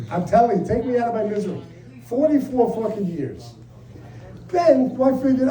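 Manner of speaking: usual speaking voice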